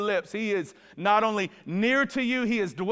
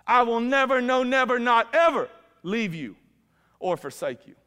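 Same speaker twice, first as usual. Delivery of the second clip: high, loud, driving preacher's proclamation; preaching at full force; 5 semitones higher, 4 dB louder than usual. Acted. Preacher declaring God's promise to the room, full force. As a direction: proclaim it over the congregation.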